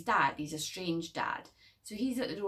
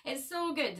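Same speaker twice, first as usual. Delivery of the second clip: put-on bubbly girlfriend; 13 semitones higher, 4 dB louder than usual. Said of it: "then she's like".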